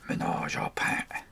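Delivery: slow whispered pun; whispered aside. Pretend. Crafter whispering a pun to herself about her Pat cutouts, slow and hushed. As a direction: breathe the pun out slowly as a secret joke.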